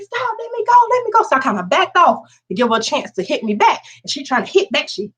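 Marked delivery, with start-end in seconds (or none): 0.0-1.2 put-on high, pleading voice of the beaten girl; lightly voiced girl pleading to be released; girl's high plea. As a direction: beg to be let go, pitched way up.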